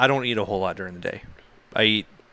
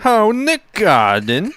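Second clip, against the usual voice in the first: high, slow mock southern German accent; 10 semitones higher, 5 dB louder than usual. Shown as a demonstration. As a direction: drawl it like a southern German guy. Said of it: "A southern German guy".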